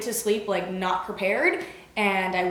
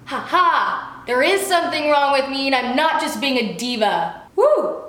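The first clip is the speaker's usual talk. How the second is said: higher-pitched mock triumph; gloating; 5 semitones higher, 5 dB louder than usual. Patient vindicated that something really is wrong, gloating. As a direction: crow it in self-vindication. Retold gloating at being proved right.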